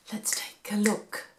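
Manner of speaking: ordinary delivery, nothing marked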